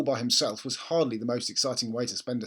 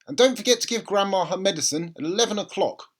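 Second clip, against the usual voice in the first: a hint of a brisk mother, a bit louder; busy mum heading out the door; mother's voice; 6 semitones higher, 5 dB louder than usual. A narrator's reading. Mother voiced in read story.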